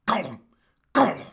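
creature voice with loud throat-cough cries; Gollum. Pretend. Creature reassures the fighter, throaty impression. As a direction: croak it, then cough out the name. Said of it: "voice acting skills for Gollum".

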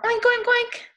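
high, loud oinking; pig oinks. Acted. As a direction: oink playfully like a pig. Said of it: "They go".

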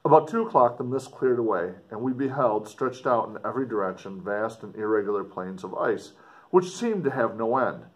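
put-on earnest, dramatic reading of an old letter; story narration. A narrator's reading. Explorer writing home to his sister, dramatic story reading. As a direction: read it like a gothic novel, with feeling. Reads letter four aloud.

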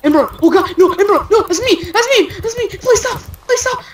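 high-pitched, frantic begging; panicked pleading. Real, not acted. Player begging Ember to stop attacking, frantic pleading. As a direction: plead in short repeated bursts, pitch climbing high.

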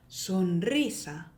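slow "sonrisa" with the r trilled after n; rolled r. Shown as a demonstration. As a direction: roll the r in "sonrisa". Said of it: "어떻게 읽으셔야 되냐면요".

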